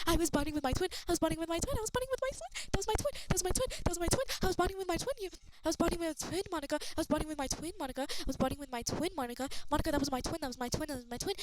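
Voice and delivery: high, loud outraged shouting; outraged yelling. Pretend. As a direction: yell like she ruined everything. Ranting at Monika over the interruption.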